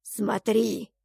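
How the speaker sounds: short imperious command; commanding god. A narrator's reading. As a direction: order it like a god. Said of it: "приказал Бог".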